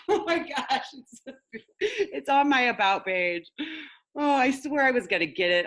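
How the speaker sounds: high, giggly self-teasing; embarrassed laughter. Real, not acted. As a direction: laugh off the flub. Laughing at own mistake.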